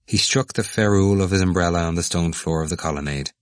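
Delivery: ordinary delivery, nothing marked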